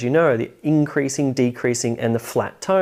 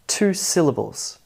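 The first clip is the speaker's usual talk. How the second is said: ordinary talk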